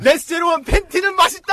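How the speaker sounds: loud high yell; shouted heckle. Real, not acted. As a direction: yell the taunt out.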